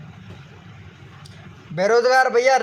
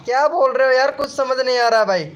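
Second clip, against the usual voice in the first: normal talking voice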